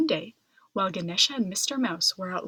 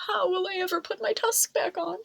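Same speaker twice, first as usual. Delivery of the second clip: wailing; 8 semitones higher, faster than usual. A narrator's reading. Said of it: "Ganesha wailed".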